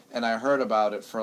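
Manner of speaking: ordinary talk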